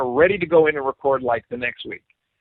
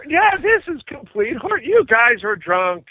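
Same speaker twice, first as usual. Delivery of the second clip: incredulous; 8 semitones higher than usual. Retold or imitated. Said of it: "Nobody's gonna believe this".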